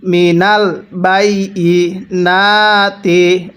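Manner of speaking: slow, drawn-out tajweed chanting; slow Quran recitation. Shown as a demonstration. Teacher demonstrating recitation to students, slow chant. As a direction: recite each syllable long and measured. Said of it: "কিভাবে পড়তে হবে?".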